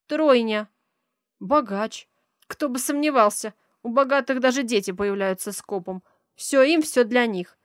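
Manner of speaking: loud, indignant rant about the rich. Acted. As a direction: let the resentment spill out loudly.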